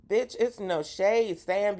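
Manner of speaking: ordinary delivery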